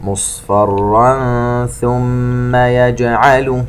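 slow chanted tajweed recitation; Quran recitation. Acted. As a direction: draw out each vowel, chant it. (تلاوة مرتلة بطيئة)

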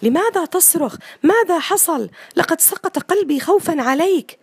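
mother bursting in on her son, angry and scared. Acted. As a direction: scold him, still shaken with fear. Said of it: "فقالت الأم بغضب".

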